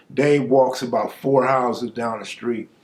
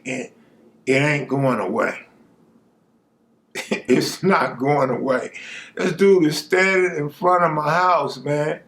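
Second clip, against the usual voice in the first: usual speaking voice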